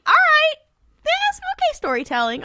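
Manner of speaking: very high-pitched, put-on approving voice; mock approval. Co-host reacting to the show's storytelling, high mock approval. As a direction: squeak it out in grudging praise.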